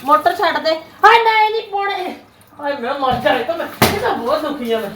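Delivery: high, loud melodramatic wailing; dramatic loud aunty. Acted. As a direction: wail like a fussy Punjabi aunty. Mother panics over water filter. (ਉੱਚੀ ਡਰਾਮੇਬਾਜ਼ aunty ਵਾਲੀ ਆਵਾਜ਼)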